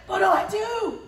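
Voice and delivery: high-pitched wail of mock panic; freaked out. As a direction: cry out helplessly, retelling the panic. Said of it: "I was freaked out".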